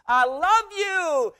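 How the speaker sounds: high, drawn-out loud call; long shout of affection; shouting love. Acted. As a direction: holler it slowly to faraway friends. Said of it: "Paul shouts to the Philippians".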